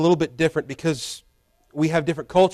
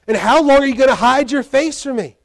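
higher, louder, rushed lament; anguished plea; 7 semitones higher, 4 dB louder than usual. Retold or imitated. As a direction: pour it out fast like a man who feels abandoned. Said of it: "And then he says".

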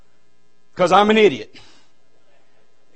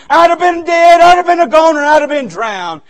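emphatic raised cry; 5 semitones higher, 4 dB louder than usual. Real, not acted. Preacher stressing his near death.

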